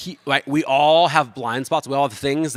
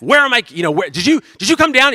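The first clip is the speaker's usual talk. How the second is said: much higher, louder accusing yell; husband yelling accusations at his wife; loud, frantic demand; 11 semitones higher, 9 dB louder than usual. Retold or imitated. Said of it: "I start yelling at my wife".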